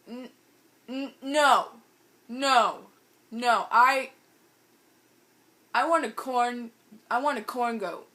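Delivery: loud, stammered refusal; mock stubborn protest. Friend refusing to get in the car, mock stubborn protest. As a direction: dig your heels in, imitating a tantrum.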